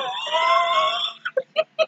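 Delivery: high squealing laugh that tails off; helpless laughing fit. Real, not acted. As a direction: crack up breathlessly. Laughing fit at own story.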